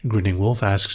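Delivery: ordinary talk, nothing marked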